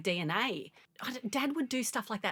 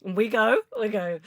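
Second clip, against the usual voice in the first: normal talking voice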